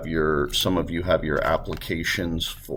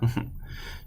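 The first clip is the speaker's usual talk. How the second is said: short soft laugh; 7 dB quieter than usual. Real, not acted. Laughs at own et cetera.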